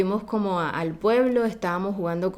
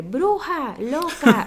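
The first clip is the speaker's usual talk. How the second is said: put-on childish taunt; 6 semitones higher, 4 dB louder, much slower than usual. Preschool kids taunting her.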